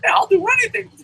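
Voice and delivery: very high mock eager voice; high parody of a helpful worker; YouTube employee. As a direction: grovel in a squeaky put-on pitch. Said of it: "when you meet these YouTube employees".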